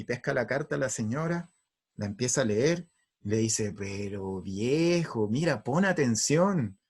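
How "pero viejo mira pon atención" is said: slow, soft coaxing; gentle wife. Acted. Wife calming husband before rereading the letter, gentle. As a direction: get his attention tenderly and unhurriedly.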